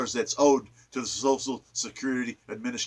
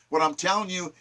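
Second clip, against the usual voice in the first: usual speaking voice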